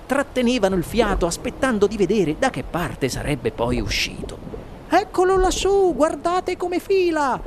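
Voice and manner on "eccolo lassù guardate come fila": high-pitched put-on onlooker voice; excited cry. A narrator's reading. Onlooker pointing at the boy in the pipe, shouted. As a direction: call out, stretching the end. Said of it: "gridò qualcuno indicando in alto".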